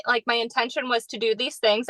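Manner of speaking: ordinary talk, nothing marked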